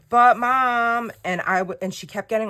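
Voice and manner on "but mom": higher and louder whine, pushing harder; imitating a daughter's rising whine; shriller whiny daughter. Daughter pushing mom again, louder high whine. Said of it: "her voice kept getting more and more shrill".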